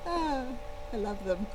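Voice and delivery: ordinary delivery, nothing marked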